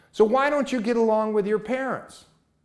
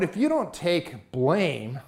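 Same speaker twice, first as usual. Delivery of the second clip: slow, drawn-out warning with weight on you; slow emphasis; 2 semitones lower, much slower than usual. Real, not acted. Youth speaker slowing down to warn listeners about blame. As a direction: lean in and slow right down on each word.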